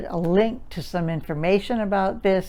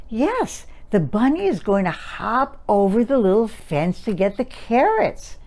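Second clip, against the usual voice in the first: put-on sing-songy baby talk; 3 semitones higher than usual. Shown as a demonstration. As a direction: lilt it for a little child looking at pictures. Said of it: "Did you notice the sing-songy expression?".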